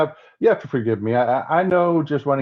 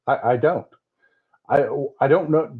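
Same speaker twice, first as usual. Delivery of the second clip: ordinary talk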